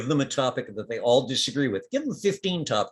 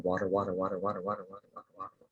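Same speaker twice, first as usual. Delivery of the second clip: softer water chant fading to nothing; whispering plant voices; 4 semitones lower, 8 dB quieter, much faster than usual. Acted. Plant voices begging the player for water, fading. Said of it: "little voices going".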